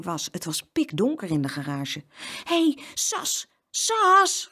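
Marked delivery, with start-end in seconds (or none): 2.4-4.5 high-pitched, anxious calling, getting louder; frightened call. A narrator's reading. Boy waking in a dark garage, calling scared. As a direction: call your sister awake, scared. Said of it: "riep hij bang".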